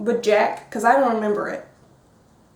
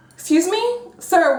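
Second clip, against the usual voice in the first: higher-pitched mock indignation; indignant challenge; 7 semitones higher than usual. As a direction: challenge him, pitch lifted in disbelief. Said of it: "And I was like".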